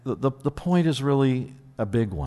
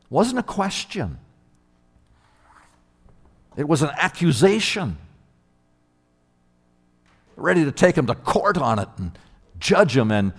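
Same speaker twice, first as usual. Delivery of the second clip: ordinary delivery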